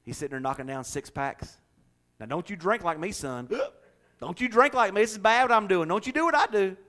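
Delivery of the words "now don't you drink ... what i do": hypocritical dad lecturing his son, higher and louder; a beer-drinking dad wagging his finger; preachy dad. Retold or imitated. Dad with a six pack warning his son, rising stern lecture.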